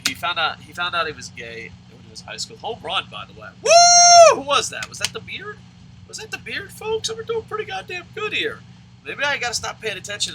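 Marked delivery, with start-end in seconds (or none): 3.6-4.4 loud, high-pitched cheering whoop; real whoop for a home run; excited whoop. Real, not acted. 4.4-10.4 high-pitched sports fan thrill; real excitement. Real, not acted. Reacting to a home run.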